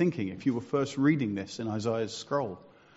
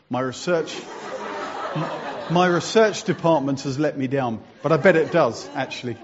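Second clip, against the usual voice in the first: laughing; 7 dB louder than usual. Real, not acted. Laughing, teasing his colleague.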